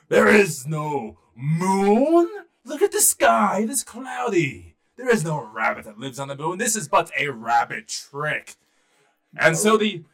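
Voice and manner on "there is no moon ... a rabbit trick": loud, slow, pompous elephant king; big regal elephant, drawn-out words. Acted. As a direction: boom it out, dismissing the little rabbit. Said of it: "he said in his elephant voice".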